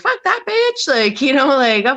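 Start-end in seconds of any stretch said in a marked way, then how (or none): 0.0-0.9 dismissive high sneer. Retold or imitated. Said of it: "someone being like".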